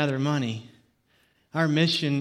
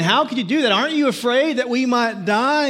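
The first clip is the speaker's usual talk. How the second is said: high, louder anxious pleading; panicked upset man; 8 semitones higher, 6 dB louder than usual. Retold or imitated. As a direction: fret aloud like a man stranded and scared. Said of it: "who was so upset".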